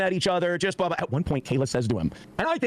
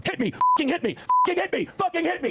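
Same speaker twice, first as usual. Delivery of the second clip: much higher, strained mock yell; retold yelling. Retelling a front yard fight, mock yelling. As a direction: imitate a man yelling, pitch pushed way up. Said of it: "Nick is yelling at Kayla".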